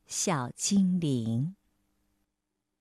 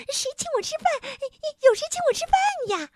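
very high, fast squeal; thin shrieking little creature; 3 dB louder, faster than usual. A narrator's reading. Hungry little man crying out for someone to feed him, thin shriek. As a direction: squeak it out shrilly like a tiny goblin. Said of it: "小人儿不断用一种细细的声音尖叫".

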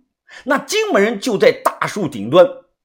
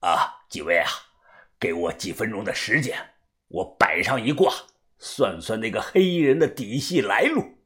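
put-on low, soft elderly man's voice; old fortune-teller; 7 semitones lower, 8 dB quieter than usual. Old master asks the group for time to divine, old fortune-teller.

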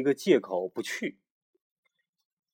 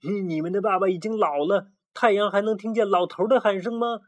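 higher, louder sneering jeer; mocking taunt; 9 semitones higher, 4 dB louder than usual. The chubby rooster mocks the chicks about their aging father, sneering. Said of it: "小胖墩儿嘲笑说".

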